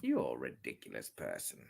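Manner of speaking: deep, soft teasing voice; mock low voice. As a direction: drop low and quiet to tease. Teasing a viewer.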